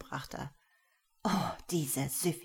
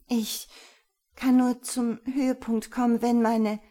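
higher and louder, defiant snap at the end; cornered woman answering back cheekily, louder and higher; snippy; 7 semitones higher, 7 dB louder than usual. A narrator's reading. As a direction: fling the answer back at him. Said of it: "Das schnippische Wort".

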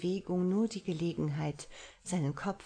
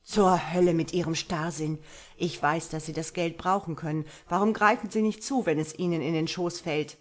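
angry outburst, louder and fast; 7 dB louder than usual. A narrator's reading. Marlowe rages at stubborn Violet, loud and fast. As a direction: berate her for her stubbornness. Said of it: "Er schlug wütend mit der Faust".

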